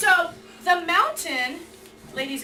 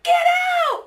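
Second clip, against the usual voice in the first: partner's yelled order; acted urgent shout; 13 semitones higher, 6 dB louder than usual. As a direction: yell a warning across the water. Acting partner yelling to leave water.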